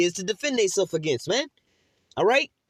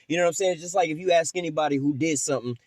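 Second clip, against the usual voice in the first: ordinary talk, nothing marked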